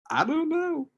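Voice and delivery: very high-pitched mock wail; mock crying. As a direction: wail it like a hammed-up sob. Imitating answering wife while bawling.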